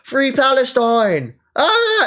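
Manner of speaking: slow, high, loud hammed-up cry of "Free Palestine"; mock protest scream. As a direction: ham up a protester's wail, stretching it out. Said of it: "He was like".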